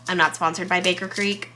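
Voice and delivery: normal talking voice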